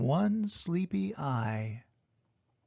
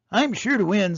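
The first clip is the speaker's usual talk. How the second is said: cocky; 4 semitones higher, 7 dB louder than usual. A narrator's reading. Jackrabbit boasting.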